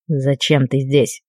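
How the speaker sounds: low, slow, icy dark lord; cold icy voice. A narrator's reading. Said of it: "ледяным голосом произнёс он".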